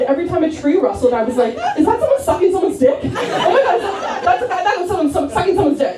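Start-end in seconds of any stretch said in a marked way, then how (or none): 1.8-3.0 high, fast paranoid questioning, played for laughs; hammed-up jittery suspicion; mock paranoid alarm. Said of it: "I was so paranoid". 3.0-6.0 high, rushed, stammering alarm for laughs; hammed-up paranoid freak-out; mock paranoid panic. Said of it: "I was so paranoid".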